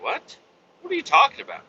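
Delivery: high-pitched, retold disbelief; baffled reply. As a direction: act out being baffled, voice way up high. Retelling own baffled reply.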